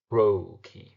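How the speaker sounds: TRO-chee, strong first syllable, falling second; "trochee" as a strong-then-weak beat; first syllable stressed. Shown as a demonstration. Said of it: "sound of the word trochee actually reveals its meaning".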